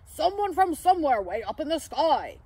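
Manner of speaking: loud, urgent character voice; Santa. A narrator's reading. Santa reporting someone in trouble, urgent cry. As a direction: call it out in alarm. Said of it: "I heard him cry".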